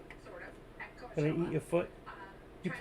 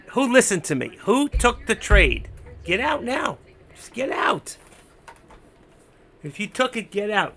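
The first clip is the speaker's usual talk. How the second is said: excited; 4 semitones higher, 6 dB louder than usual. Real, not acted. Urging viewers to sell.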